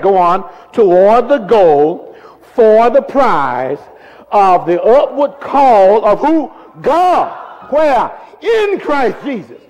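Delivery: loud, high, drawn-out emphatic reading; strong emphasis. Shown as a demonstration. Preacher getting everybody to read along, strong emphasis. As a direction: punch every phrase out at full preaching volume. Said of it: "with strong emphasis".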